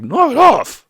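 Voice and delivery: loud, high mock yell; irritated outburst. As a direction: act out an exasperated shout. Kiryu angrily rebuffs Yuya.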